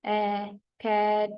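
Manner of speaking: ए stretched very slowly before कैट; drawn-out vowel. Shown as a demonstration. Teacher stretching the vowel ए in कैट for students. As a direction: hold ए long, then say कैट. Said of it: "और आगे ये कैट".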